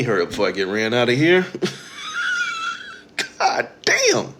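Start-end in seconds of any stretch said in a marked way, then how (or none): 1.5-2.9 shrill burst of laughter; high shrieking laugh. Real, not acted. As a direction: cackle at yourself. Laughs at own rant.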